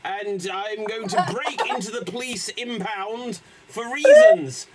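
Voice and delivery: builds to a loud high yell on reasons; governor shouting. Acted. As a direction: blurt the last word out loud. Governor character, shouts last word.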